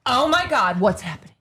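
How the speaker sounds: theatrical alarmed mom; mother impression. Mom walking into the room, imitated as dramatic diva. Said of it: "walking into it and just be like".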